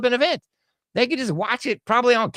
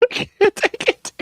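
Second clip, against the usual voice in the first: barely holding it together from laughter; laughing helplessly; 11 semitones higher, 3 dB louder than usual. Real, not acted. Host cracking up over the judge's name, laughing.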